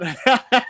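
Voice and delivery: high, loud cackling laugh; loud burst of laughter. Real, not acted. Laughing at the bad joke he told. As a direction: break into a big laugh.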